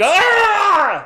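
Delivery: high, loud cartoon scream; a startled comic shriek; mock scream. Imitating Kellen startled by the coach, loud high mock scream. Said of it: "Kellen's hearing scream".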